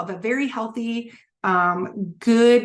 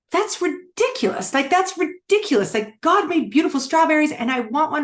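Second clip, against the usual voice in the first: indignant; 7 semitones higher than usual. Retold or imitated. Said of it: "I'm like".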